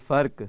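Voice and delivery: فرق said as "farq" in one syllable; correct pronunciation. Shown as a demonstration. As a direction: model فرق with r running straight into the final consonant. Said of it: "یہ اس کے صحیح تلفظ ہیں".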